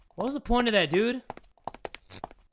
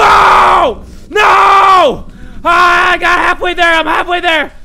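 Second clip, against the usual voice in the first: panicked screaming; 9 semitones higher, 13 dB louder, slower than usual. Real, not acted. Chased by the mascot.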